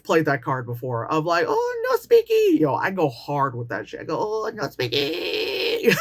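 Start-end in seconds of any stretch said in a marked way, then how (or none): 1.5-2.6 much higher, slow mock broken-English accent. Dodging strangers by faking no English, high and slow. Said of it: "stereotypical accent". 4.2-6.0 slow, drawn-out mock broken-English accent. Pretending not to speak English, slow accented drawl. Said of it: "stereotypical accent".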